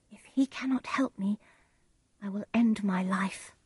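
hushed private thought; soft, despairing murmur to oneself; Juliet. A narrator's reading. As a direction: think aloud, soft and half-whispered. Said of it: "Juliet thought".

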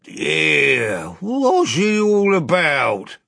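slow, high, slurred complaint; protesting doorkeeper. A narrator's reading. Doorkeeper whose hat was knocked off, slurred protest. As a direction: drag it out as a slack-mouthed protest. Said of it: "began the doorkeeper".

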